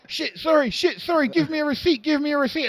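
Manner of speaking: high, urgent little plea; flustered apology. Retold or imitated. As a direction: imitate a panicky opponent, pitched high. Acts out opponent's flustered request.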